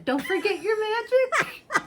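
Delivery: emphatic cheerful send-off; with an exclamation mark. Shown as a demonstration. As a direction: proclaim it brightly, laughing. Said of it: "You said it like a question".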